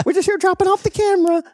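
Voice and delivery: very high falsetto imitation; high-pitched mock visitor explanation; mimicked visitor. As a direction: imitate a visitor's sing-out in a squeaky voice.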